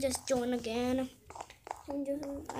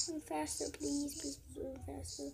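quiet pleading; 9 dB quieter than usual. Real, not acted. Fleeing, pleading.

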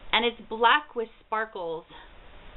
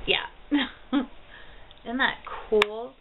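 normal talking voice

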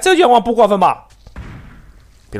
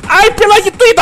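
high, loud call to stay down; urgent warning shout; 9 semitones higher, 4 dB louder than usual. Real, not acted. Player shouts at teammate not to peek, urgent shout.

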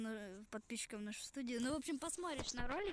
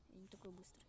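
whisper; 5 semitones lower, 13 dB quieter than usual. Real, not acted. Unvoiced whisper while seeking.

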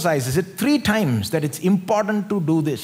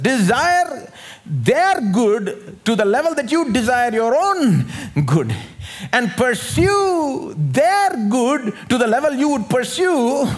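emphatic preaching; 10 semitones higher, 4 dB louder, slower than usual. Real, not acted. Preacher stresses the command.

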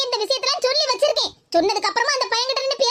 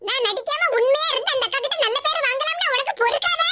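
higher, needling retort; quarrelsome woman; 3 semitones higher than usual. Acted. Geetha snaps back at her accuser in the quarrel, higher and needling. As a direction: bicker back with a wounded, sly edge. Second woman argues, other voice.

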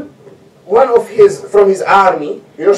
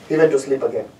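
ordinary talk

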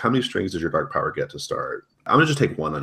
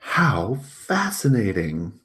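very slow, higher, drawn-out marvel; artificial fascination; 5 semitones higher, 4 dB louder, much slower than usual. Acted. Serena responds to Ellen's day, artificially fascinated. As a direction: stretch each word with put-on interest. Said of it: "how absolutely artificial it is".

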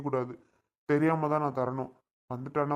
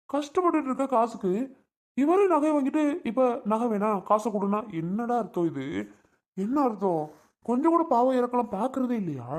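higher-pitched, exasperated outburst; indignant complaint; 8 semitones higher than usual. Acted. Acted complaint about money demand. (ஆதங்கக் கோபம், உயர்ந்த குரலில்)

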